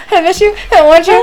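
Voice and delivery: put-on high, breathy girl voice; girly voice. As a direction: coo it like a lovesick girl. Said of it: "sound like that girl".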